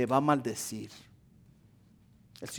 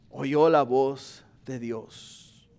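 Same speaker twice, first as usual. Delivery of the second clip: "Oyó la voz de Dios" drawn out very slowly and lower; very slow solemn repeat; 4 semitones lower, much slower than usual. Shown as a demonstration. Pastor repeating that Pablo heard God, very slow. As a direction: stretch every word, hushed awe. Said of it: "repeated even slower".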